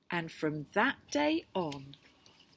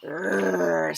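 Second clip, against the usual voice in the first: rhino growl; 6 dB louder than usual. Acted.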